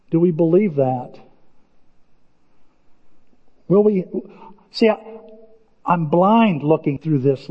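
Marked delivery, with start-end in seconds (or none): none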